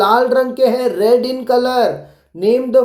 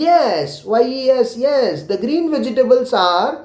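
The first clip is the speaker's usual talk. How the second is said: slow model answer; much slower than usual. Shown as a demonstration. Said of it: "पहले तो हाँ बोलना है".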